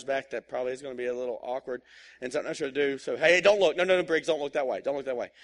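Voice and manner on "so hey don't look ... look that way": mock dad warning. Dad warns son at red light.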